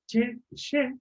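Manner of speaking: English ch and sh contrast; English che and she. Shown as a demonstration. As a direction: set "che" against "she". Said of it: "distintos sonidos y también distintos fonemas".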